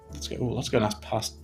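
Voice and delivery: usual speaking voice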